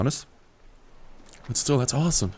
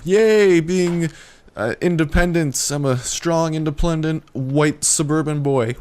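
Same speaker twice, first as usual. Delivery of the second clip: higher, hammed-up proud self-declaration; playing the sassy strong independent one, higher; mock proud cheer; 7 semitones higher, 5 dB louder than usual. Proudly announcing independence to viewers, mock cheer. Mock pride at driving alone.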